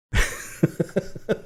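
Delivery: laugh. Real, not acted. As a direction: burst of high laughter. Host reacting to the clip.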